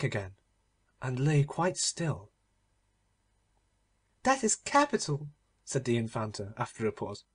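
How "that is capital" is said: high, haughty little princess voice; a spoilt princess laughing at the dwarf; mocking princess. Infanta speaks.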